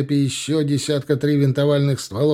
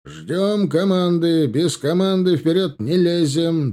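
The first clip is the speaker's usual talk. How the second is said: high, drawn-out orders; commander's shout; 6 semitones higher, 3 dB louder, slower than usual. A narrator's reading. Company commander holding his men back, shouting. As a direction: shout it slowly along the line. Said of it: "донёсся крик командира роты".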